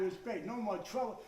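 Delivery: usual speaking voice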